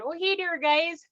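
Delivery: high Iron Range "Oh, hey there, guys"; Iron Range accent. Retold or imitated. Speaker shows fitting in up north, cheery northern Minnesota accent. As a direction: fit right in up north. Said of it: "I fit in the Iron Range now".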